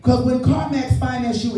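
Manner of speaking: ordinary delivery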